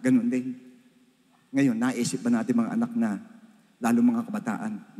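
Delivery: normal talking voice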